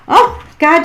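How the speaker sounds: real delighted outburst; amused exclamation. Real, not acted. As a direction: burst out amused at the spirit's joke. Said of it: "she's funny".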